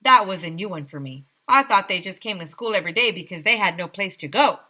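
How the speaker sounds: ordinary delivery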